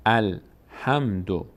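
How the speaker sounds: "الحمدو" slowly split, al, then ham-do; syllable by syllable. Shown as a demonstration. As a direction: sound out "الحمدو" with a gap after al. Teacher sounds out the word in parts.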